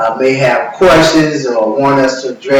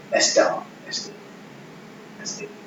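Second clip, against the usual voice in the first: whispered aside; 13 dB quieter than usual. Real, not acted. Muttered asides after the list.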